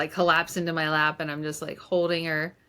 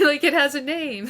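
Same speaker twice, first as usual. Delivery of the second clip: laughing; 9 semitones higher, 6 dB louder than usual. Real, not acted. Telling her podcast host the story, laughing and louder. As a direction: repeat it through a real laugh, a bit louder.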